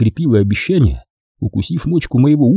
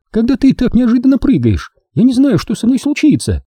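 put-on higher young woman's voice, teasing; Дженни; 13 semitones higher than usual. A narrator's reading. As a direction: play the girlfriend, sweet and teasing. Jenny voiced. (нежная игривая Дженни)

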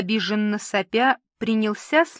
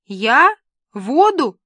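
louder, startled questioning; two separate words of disbelief; taken aback; 4 semitones higher, 7 dB louder, slower than usual. A narrator's reading. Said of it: "Разноцветная девушка опешила". (опешив, удивлённо)

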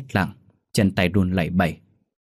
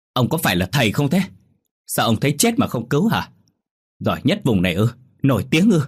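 higher, indignant scolding; angry outburst; 4 semitones higher than usual. Acted. Trang loses her temper at the shaman, scolding. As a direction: tear into the fake shaman. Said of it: "mất bình tĩnh".

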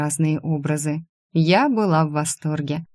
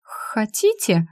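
higher, drawn-out hesitant offer; polite stammer; 5 semitones higher, much slower than usual. A narrator's reading. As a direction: stumble slowly into a polite question. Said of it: "почему это я опять заикаться стала".

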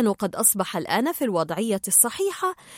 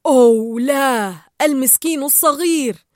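dismayed self-talk; 7 dB louder than usual. A narrator's reading. Dentist to himself over the poor little fish, loud pitying dismay. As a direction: lament it loudly, with pity. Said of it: "وقال لنفسه".

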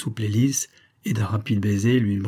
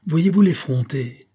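higher, slow outraged exclamation; indignant officer; 6 semitones higher, 4 dB louder, slower than usual. A narrator's reading. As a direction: draw out the indignation, pitched up. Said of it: "fort peu satisfait d'une familiarité". (officier indigné)

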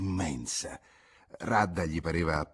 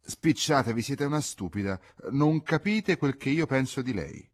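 snappish, impatient order, a touch higher and louder; irritated; 6 semitones higher, 3 dB louder than usual. A narrator's reading. The boy snapping at the old woman to hurry, irritated. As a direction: hurry her up with annoyance. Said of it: "Egli disse soltanto stizzito".

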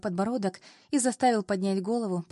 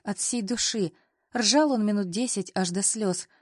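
plain reading voice

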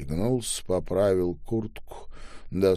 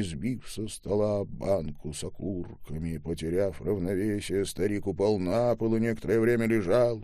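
usual speaking voice

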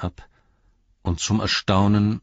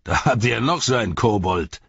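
loud, scornful jeer; envoy jeering at Pippin, loud mockery; mocking laugh; 5 semitones higher, 4 dB louder than usual. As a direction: sneer at the little hobbit. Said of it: "lachte laut".

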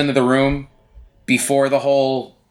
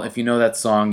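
ordinary talk

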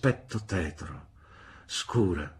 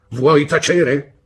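slow, loud, higher roar of her name; thundering; 8 semitones higher, 13 dB louder, much slower than usual. A narrator's reading. Brother silencing his sister, thundering and slow. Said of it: "tuonò mister Marston".

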